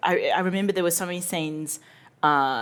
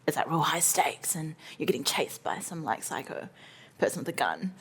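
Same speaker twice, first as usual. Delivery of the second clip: hushed dramatic whisper; 7 dB quieter than usual. Real, not acted. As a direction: drop to a whisper. Describing high stakes.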